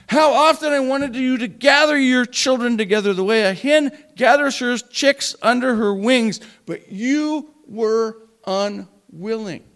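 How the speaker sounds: loud, declaiming Bible reading; dramatic scripture reading. Acted. Preacher reading Judges to the congregation, loud and dramatic. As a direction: proclaim the verses to the back row. Reads Matthew passage aloud.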